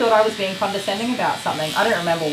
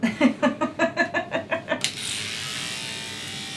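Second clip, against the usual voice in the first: breathy laugh; 8 semitones higher, 5 dB quieter than usual. Real, not acted. Laughing at story.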